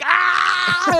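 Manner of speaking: high short yelp of pain; mock pain cry. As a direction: yelp like you just got hurt. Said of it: "stopped and went".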